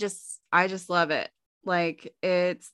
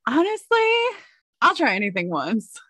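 laughing; 10 semitones higher than usual. Real, not acted. Answering through laughter.

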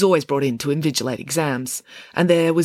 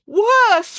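high, loud anguished cry; voice cracking; 6 dB louder than usual. Acted. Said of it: "his voice cracking with emotion".

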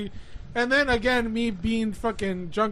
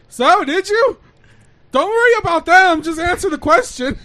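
higher and louder, pressing; insistent loud demand; 6 semitones higher, 8 dB louder than usual. Retold or imitated. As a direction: push him to answer.

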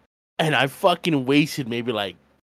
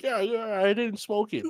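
high, soft mock-innocent voice; sheepish denial; 8 semitones higher, 4 dB quieter than usual. Boy denying the cigarette to his relatives, mock innocence. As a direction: play the innocent kid, small and high.

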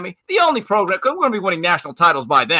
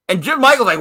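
normal talking voice

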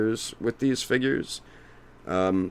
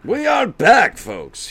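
announcer voice; 12 semitones higher, 7 dB louder, slower than usual. Pretend. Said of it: "Okay, we're back".

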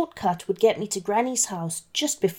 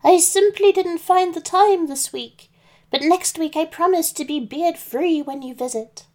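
put-on old granny voice; 8 semitones higher than usual. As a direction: play the wolf faking a sweet granny. Wolf as Granny.

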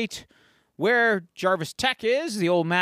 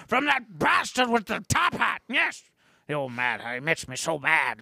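irate sidekick character. Acted. Sidekick blames the Mad Hatter, hammed-up anger. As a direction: rant in character at a hated villain.

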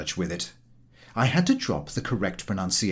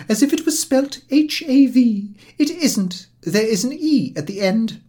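high, loud and scolding; reproachful; 13 semitones higher, 6 dB louder than usual. A narrator's reading. English lady scolding a Frenchman, reproachfully. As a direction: spell it out to him with reproach. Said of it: "said the lady reproachfully".

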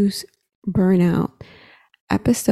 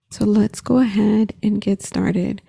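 guided meditation; 3 dB quieter than usual. Acted. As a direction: gently guide the breathing. Leads a guided breathing meditation.

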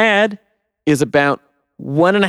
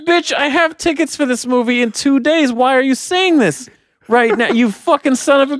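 higher, incredulous outburst at the actor; mock-furious, cussing out the spoiler; outraged rant; 9 semitones higher than usual. Fan ranting at the actor over spoilers, outraged. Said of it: "And I was like".

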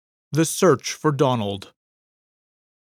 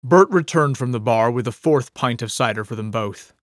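plain reading voice

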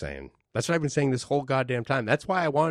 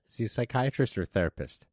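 ordinary delivery

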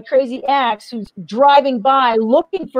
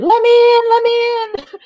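much higher, louder, begging to be let in; desperate pleading; 12 semitones higher, 5 dB louder than usual. Retold or imitated. Begging the psych unit staff at the door, desperate high cry.

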